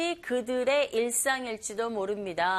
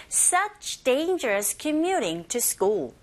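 slow, deliberate "Such dangerous commuting to school" for learners to copy; very slow teacher model; slow model reading; 2 semitones higher, much slower than usual. Shown as a demonstration. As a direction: pace it out word by word. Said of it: "저랑 똑같이 하실 수 있죠".

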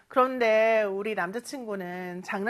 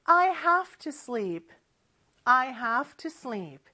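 slow practice model; much slower than usual. Shown as a demonstration. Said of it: "같이 한번 해볼까요".

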